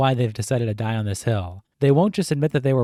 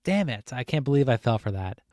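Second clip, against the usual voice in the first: ordinary talk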